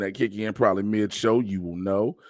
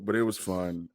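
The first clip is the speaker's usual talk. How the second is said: normal talking voice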